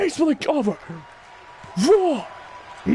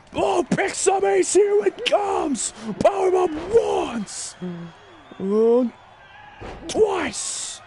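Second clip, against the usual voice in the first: excited shout; 6 semitones higher than usual. Real, not acted. Commentator calling Lesnar's powerbomb on Ace, excited shout. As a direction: yell it like the crowd just jumped up.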